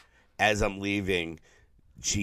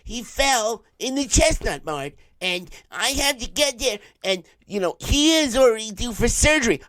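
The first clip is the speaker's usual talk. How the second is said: put-on high pleading voice of the worried girlfriend; frantic girlfriend; 12 semitones higher, 4 dB louder than usual. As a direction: squeal it like a panicked girlfriend pleading with insurance. Girlfriend on the phone.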